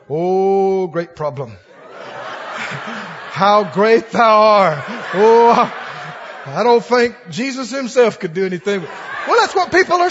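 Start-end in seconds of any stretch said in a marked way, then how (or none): 0.1-1.8 slow hammed-up hymn-like reverence; mocking people who revere their problems; mock awe. 3.4-4.8 slow, loud mock-reverent line; mocking worship of a problem; mock hymn. 5.1-8.8 slow hammed-up hymn-like reverence; mocking people who revere their problems; mock awe.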